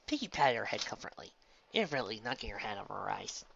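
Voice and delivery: plain reading voice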